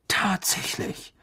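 slow breathy whisper of fascination; curious whisper. A narrator's reading. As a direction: hush it like a delighted secret. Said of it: "sagte Mister Weasley neugierig".

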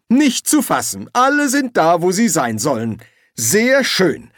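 high-pitched mock amazement; sarcastic. A narrator's reading. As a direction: heap on the sarcastic praise. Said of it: "sagte Silberfisch sarkastisch".

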